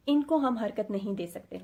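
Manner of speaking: usual speaking voice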